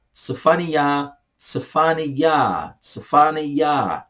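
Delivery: slow, spelled-out "Sefania", each syllable drawn out; Hebrew pronunciation drill. Shown as a demonstration. Teacher drilling students on "Sefania", slow and deliberate. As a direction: say "Sefania" slowly, like teaching a class. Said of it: "teacher drills Hebrew name".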